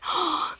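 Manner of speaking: high, sudden intake of breath; short surprised gasp; excited gasp. Acted. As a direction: gasp in acted amazement. Acts surprise as kite rises.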